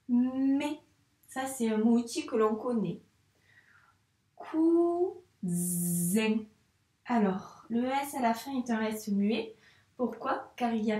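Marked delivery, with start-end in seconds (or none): 4.4-6.4 cousin read slowly, syllable by syllable. Shown as a demonstration. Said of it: "On lit ensemble". (lecture lente de cousin)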